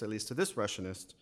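ordinary delivery

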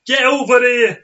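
put-on loud high New York accent shout. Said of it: "That's your New York accent".

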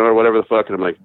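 ordinary talk

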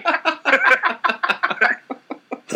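high, breathy burst of real laughter; laughing. Real, not acted. Cracking up after a botched Canadian impression. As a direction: crack up at your own bit.

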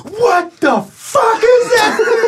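high, laughing shocked yell at the sight; retelling dad's stunned outburst, cracking up laughing. Retold or imitated. Son retelling dad seeing the wrecked pool, high laughing shocked yell.

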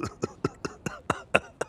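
breathy villain cackle; a wicked unvoiced laugh; evil laugh. Villain laughs after gloating.

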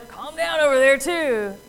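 mock telling-off, projected; acted rebuke. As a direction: call across to panicking people, firmly. Acting out a rebuke to disciples.

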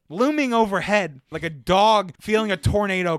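loud, hammed-up retold plea; dramatic pleading. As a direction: build it into a comic prophecy of doom.

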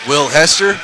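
slow, drawn-out lineup announcement; announcer voice. Acted. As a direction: call each name out slowly.

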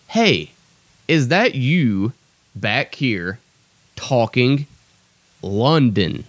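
word by word, with a pause after each chunk; measured quote, broken into pieces; deliberate verbatim repeat. Shown as a demonstration. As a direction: spell it out slowly for the audience. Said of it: "I'll repeat that".

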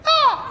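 sudden high loud yelp; startled yelp. Shown as a demonstration. Comic demonstrating his scared noise, high loud yelp. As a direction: jump with a scared shriek. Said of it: "I'm gonna make this noise".

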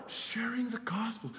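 slow breathy whisper, no voice; hushed reverent whisper; whispered answer. Real, not acted. Answers his own question.

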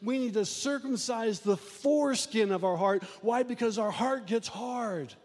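impassioned sermon delivery; preaching. Acted. Pastor preaching to his church, impassioned. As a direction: preach it to the congregation.

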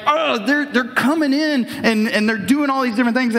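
high-pitched agitated rant; Paul worked up. Acted. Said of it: "he's getting worked up".

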